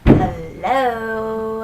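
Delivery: long drawn-out, incredulous hello; indignant hello, as if to someone clueless; sarcastic hello. Retold or imitated. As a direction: stretch the word in mock disbelief. Mock hello at rude train boarders.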